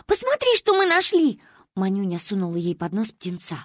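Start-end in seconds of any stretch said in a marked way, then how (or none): 0.1-1.4 put-on high, loud child voice; excited childish squeal of words; eager little girl. A narrator's reading. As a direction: show off your find, pitched way up. Child shows her find to grandmother.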